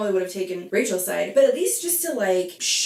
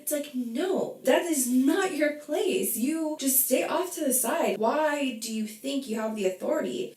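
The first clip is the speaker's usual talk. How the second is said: scolding; 6 semitones higher than usual. Real, not acted. Scolding Rachel directly.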